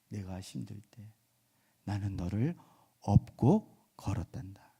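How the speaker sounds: low, soft and tender, partly whispered; soft loving voice. Retold or imitated. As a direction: comfort the child like a loving Lord. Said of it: "부드러운 사랑의 음성으로". (주님이 사랑하는 이에게 부드럽게 속삭이듯)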